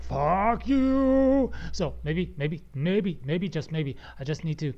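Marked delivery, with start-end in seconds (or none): 0.0-1.5 high, loud, drawn-out taunt; mock defiant yell. Voice recorder software refusing to cooperate, mock defiant yell. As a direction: voice the software jeering at its user. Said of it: "and then afterwards it's like".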